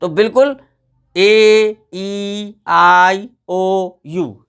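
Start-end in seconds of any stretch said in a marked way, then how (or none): 1.2-4.4 ए, ई, आई, ओ, यू each stretched and separate; long vowel sounds. Shown as a demonstration. Teacher models long sounds for pupils, long vowel sounds. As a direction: hold every vowel like its letter name. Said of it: "यही इसका स्वर है".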